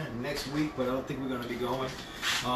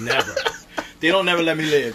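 louder, playful protest; mock complaint; 9 dB louder than usual. Real, not acted. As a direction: protest loudly but in fun. Mock complaint about teasing.

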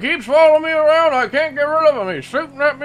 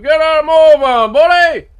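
angry shout; 4 semitones higher, 10 dB louder than usual. Acted. Angry local yells at driver.

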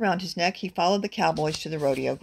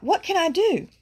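high, helpless cry; 10 semitones higher, 3 dB louder than usual. A narrator's reading. Burro hears horses' feet nearing, cries out high. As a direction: cry out for help, pitch swooping up. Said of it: "He cried out".

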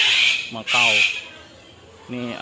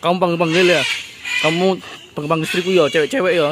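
loud, raised-pitch playful telling-off; mock scolding; 5 semitones higher, 6 dB louder, much faster than usual. Man teasing a bird for calling his wife, loud mock scolding. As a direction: pretend to confront the bird.

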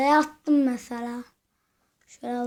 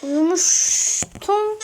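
"Uyumuştum" stretched very slowly and pitched higher; drawn-out uyumuştum; 5 semitones higher, 4 dB louder, much slower than usual. Shown as a demonstration. Repeating to viewers that he had slept, drawn out slowly. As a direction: drag out "Uyumuştum" like a sleepy yawn. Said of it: "Uyumadım mı lan?".

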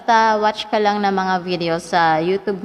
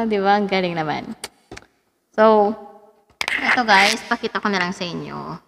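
ordinary delivery

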